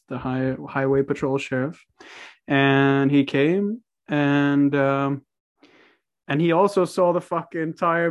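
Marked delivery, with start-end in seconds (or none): none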